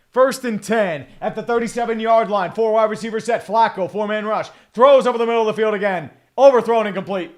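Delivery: energetic football commentary; live play-by-play. Acted. Streamer calling a Bengals drive, live play-by-play. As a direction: call the play as it unfolds.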